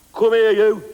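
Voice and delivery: loud, put-on animal call; giant clam mating call. Shown as a demonstration. Comic impersonating a giant clam, loud mating call. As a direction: belt out a silly mating call. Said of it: "mating call of the giant clam".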